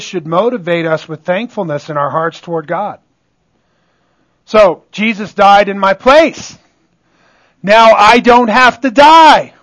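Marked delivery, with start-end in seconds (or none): 4.4-9.5 loud, rising preacher's declaration; exultant proclamation. Real, not acted. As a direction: build to a jubilant, ringing shout. Preacher exults in being saved.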